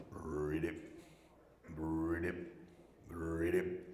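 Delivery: low, rolled frog croaks; imitated frog ribbit. Comic following a froggy taunt, imitating low frog croaks. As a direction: croak slowly spaced ribbits, low in pitch.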